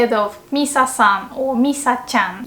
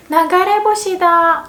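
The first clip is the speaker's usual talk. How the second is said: high, louder surprised gasp of discovery; 7 semitones higher, 4 dB louder than usual. Shown as a demonstration. Teacher sees a falling star, high surprised. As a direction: spot a falling star, amazed. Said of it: "you see a falling star".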